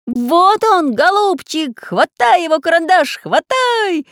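high, loud eager yelling; excited shout. Acted. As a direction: shout it all together, urging. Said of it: "закричали одновременно".

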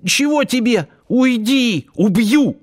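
high, squeaky frightened cry; hoarse squeak. A narrator's reading. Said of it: "севшим голосом пискнул". (сиплый испуганный писк)

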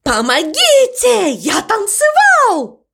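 high, drawn-out cry of alarm; horrified exclamation. A narrator's reading. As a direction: cry for help, stretched and high. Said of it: "воскликнул ворон".

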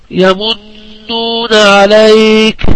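very slow chant, humming on the doubled ن of يمنون; slow recitation with a long nasal ن in يمنون; tajweed ghunnah recitation. Shown as a demonstration. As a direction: hold the نّ in يمنون nasally and stretch عليك. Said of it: "من الفعل يمنون".

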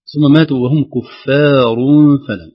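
كفار with a thin ف and a heavy ر; teacher reciting كفار; slow loud recitation. Shown as a demonstration. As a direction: recite كفار slowly and clearly. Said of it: "كذلك".

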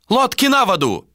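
high, carrying order; shouted command. A narrator's reading. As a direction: call it out to the sailors.

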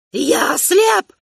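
put-on high cracked shout of a blind old man; old emperor's cry. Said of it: "закричал старый император".

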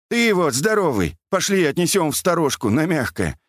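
put-on high-pitched fussing old man; high old-man pleading; old man's voice. A narrator's reading. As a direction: fuss while giving orders in a high voice. Old man gives orders.

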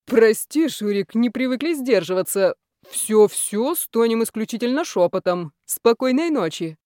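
calling out apology. A narrator's reading. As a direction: apologise to someone at the door. Boss calls apology through door.

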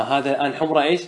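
normal talking voice

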